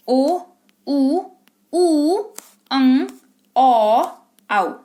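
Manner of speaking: ओ, ऊ, अं, औ, आउ each held alone; isolated vowel sounds. Shown as a demonstration. Teacher listing the sounds of O, each held apart. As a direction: sound out ओ, ऊ, औ separately and firmly. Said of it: "ढेर सारा sounds create करता है".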